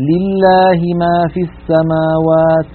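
لله with a thin lam; light lam. Shown as a demonstration. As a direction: slowly keep لله light. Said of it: "ونقول". (لام مرققة)

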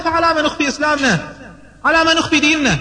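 high, insistent questioning; impassioned protest. Acted. Umar asks the Prophet why hide the faith, impassioned protest.

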